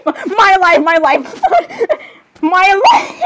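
high outburst breaking into squeals; squealing meltdown. Acted. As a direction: cry out, then dissolve into very high giggles. Overwhelmed squealing in skit.

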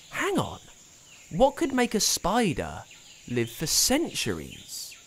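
high, puzzled wondering; mild surprise; dawning realisation. A narrator's reading. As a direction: piece it together. Sudden thought.

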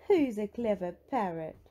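lightly voiced granny cooing to a pet; a hint of doting granny; doting praise. A narrator's reading. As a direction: praise the parrot fondly. Granny praises parrot, which then copies.

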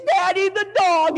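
put-on high, drawn-out kids' cry; mock children's wail to Dad; excited children. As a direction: call out like a kid alarmed for the dog. Kids cry out over the dog.

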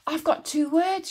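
high, bright excited cry. A narrator's reading. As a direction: burst out with the discovery. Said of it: "she cried".

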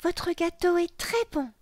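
put-on high child's voice; high-pitched, pleased child; little girl enjoying a treat. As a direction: praise it as a delighted small girl. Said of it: "dit Lola en se régalant".

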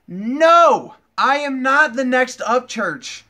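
emphatic denial. Real, not acted. Denying he copies Upchurch.

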